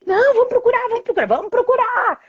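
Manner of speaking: put-on high, excited little girl; can't wait to start hunting; eager child. Daughter begging mother to keep searching for the fairy.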